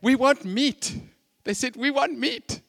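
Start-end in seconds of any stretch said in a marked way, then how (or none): 0.0-0.9 high, loud, hammed-up gripe; loud complaining. Israelites sick of manna demanding meat, loudly complaining. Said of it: "And they're complaining". 1.7-2.6 high, insistent demand; mock complaining. Israelites complaining to Moses and demanding meat, in mock protest. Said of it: "they start complaining".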